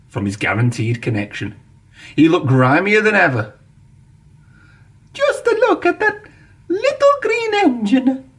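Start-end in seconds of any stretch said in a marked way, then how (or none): 5.1-8.3 a diva gushing over a little green engine; delighted exclaiming. A narrator's reading. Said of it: "Alicia Botti exclaimed".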